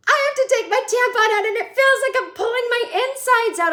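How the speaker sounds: put-on high, loud hysterical-teen wail; Lily. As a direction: wail it in mock agony. Imitating Lily's porta potty meltdown.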